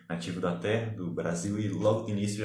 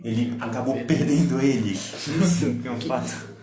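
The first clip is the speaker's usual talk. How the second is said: laughing; 4 semitones higher, 5 dB louder than usual. Real, not acted. Laughing after awkward moment.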